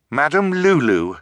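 loud firm address; firm but shaky; firmly. A narrator's reading. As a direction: call her name sternly. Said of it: "Violet said firmly".

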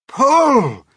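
high, full-force command; urgent yelled order; shouted. A narrator's reading. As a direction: shout it to get everyone hauling. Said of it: "shouted the old green grasshopper".

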